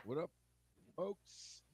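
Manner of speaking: faint, muffled and far away; like talking from inside a closet; through a wall. Real, not acted. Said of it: "in a closet ten miles away whispering".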